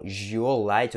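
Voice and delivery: желать wrongly said "jiolát"; pronúncia errada com O. Shown as a demonstration. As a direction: turn the first syllable of желать into a slow "jio". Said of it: "e não желать". (желать errado, com "jio" no começo)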